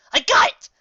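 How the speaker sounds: loud, high eureka cry; excited. Acted. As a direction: burst out with the idea. Rainbow gets a prank idea.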